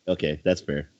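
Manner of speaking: usual speaking voice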